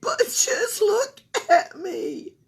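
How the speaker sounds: high, slow whispered wail; upset child. Acted. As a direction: plead in dismay. Camilla upset.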